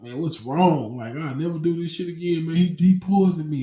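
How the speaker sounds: put-on a scared kid realizing he's been poisoned; panicky. Alone on the stairs, panicking about the brownie, voice climbing.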